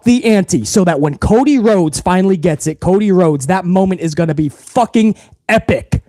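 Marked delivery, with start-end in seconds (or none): none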